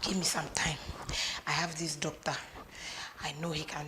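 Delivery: soft, hushed whisper. Acted. Mother planning in secret with her daughter, whispering. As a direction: whisper it so no one hears.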